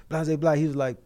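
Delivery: usual speaking voice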